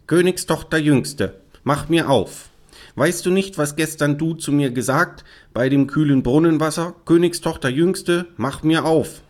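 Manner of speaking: high, insistent frog call. Acted. Frog reminding the princess of her promise, calling insistently. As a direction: call through a door in a chanting rhyme. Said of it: "klopfte es zweimal und rief".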